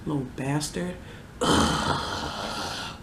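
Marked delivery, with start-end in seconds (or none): 1.4-3.0 disgusted groan. Real, not acted. Disgusted after scolding.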